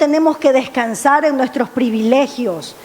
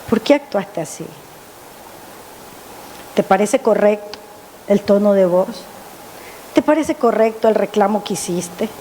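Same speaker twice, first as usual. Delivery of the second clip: lightly voiced divine rebuke, pitched lower; voz del Señor; 4 semitones lower, 4 dB quieter than usual. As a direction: question gently but firmly, in a lower voice. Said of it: "la voz del Señor". (voz más grave, de suave reproche)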